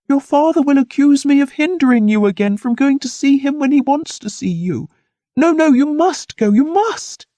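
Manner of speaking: high, urgent insistence; anxious high-pitched plea; agitated pleading. A narrator's reading. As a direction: push him away while hiding fear.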